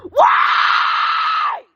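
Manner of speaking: full raw scream at the top of her voice; real scream. Real, not acted. Letting her inner child finally scream, raw full-voice scream. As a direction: let out the scream she held back. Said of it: "I needed to scream".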